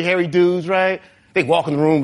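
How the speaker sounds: ordinary talk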